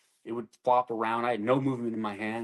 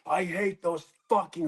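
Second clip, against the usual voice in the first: higher, louder bitter snarl; angry outburst; 7 semitones higher, 3 dB louder than usual. Retold or imitated. Wounded soldier raging about his leadership, angry. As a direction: spit it at the leadership. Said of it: "I was like".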